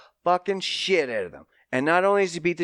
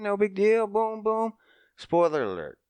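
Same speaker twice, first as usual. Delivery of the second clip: ordinary talk, nothing marked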